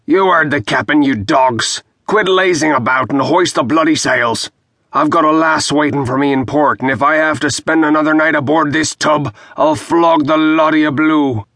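rough first mate yelling orders; bawling at the crew. A narrator's reading.

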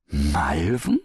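puzzled rising question; amazed little query; astonished. A narrator's reading. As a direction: echo it in disbelief. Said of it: "staunte die Frau".

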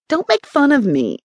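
stung little-sister protest; demanding. A narrator's reading. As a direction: demand it, pitch jumping up. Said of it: "she demanded".

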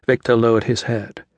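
ordinary delivery

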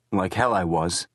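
usual speaking voice

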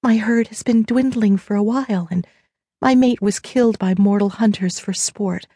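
understated tearful deer shifter appeal. A narrator's reading. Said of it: "eyes filled with tears as she went on".

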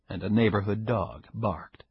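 ordinary delivery, nothing marked

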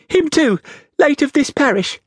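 put-on very high, earnest child voice; frightened small boy. A narrator's reading. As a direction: pipe up like a little boy. Frightened boy answers convict.

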